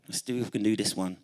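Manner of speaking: normal talking voice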